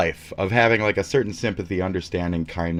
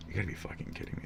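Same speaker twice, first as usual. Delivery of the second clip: breathy whisper of disbelief, barely audible; 18 dB quieter, much faster than usual. Real, not acted. Host whispering disbelief at the [name] news, hushed. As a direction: let the disbelief drop to a whisper. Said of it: "repeating disbelief at news".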